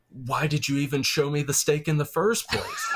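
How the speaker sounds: high frustrated outburst; exasperated shout. Retold or imitated. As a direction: yell it in disbelief. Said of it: "It's like".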